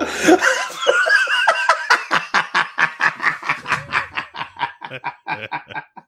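loud laughter. Real, not acted. Laughing at own joke.

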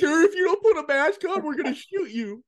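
high mock guard. Podcaster mocking a guard threatening to shoot, in a squeaky mock voice. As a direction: squeal the threat like a cartoon cop. Mocking armed mask enforcer.

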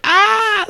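very high mock scream of terror. Retelling zombie attack dream, high mock scream. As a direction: shriek like bitten by a zombie. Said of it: "getting attacked by zombies".

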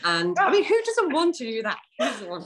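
high, loud enthusiastic pitch; enthusing. Real, not acted. Host selling the retreat day, loud and excited. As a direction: sell the dream with glee.

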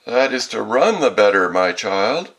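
loud, high mock-granny reply; wolf posing as grandmother. A narrator's reading. Wolf explaining its legs to the girl, fake granny voice. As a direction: belt it out as a disguised wolf.

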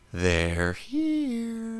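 put-on high, soft creepy little girl; creepy child voice. Host quoting a horror movie for cohost, creepy child voice. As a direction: breathe it like the Poltergeist girl.

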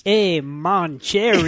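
put-on slow, hammy French accent; mock French waiter. Mocking Lumiere's French accent.

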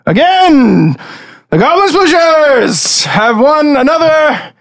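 high, slow, drawn-out bored announcer voice. Acted. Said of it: "Sounds bored".

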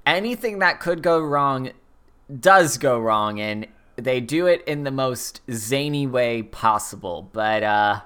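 ordinary talk, nothing marked

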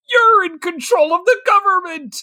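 high, strained mock accusation; conspiracy theorist. Husband accusing his wife, mock conspiracy rant. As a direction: cry it out in hammed-up paranoia. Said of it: "big conspiracy theorist energy".

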